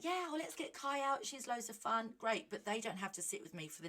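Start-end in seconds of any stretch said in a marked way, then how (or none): 0.0-2.1 eager friends. Retold or imitated. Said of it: "people would be like".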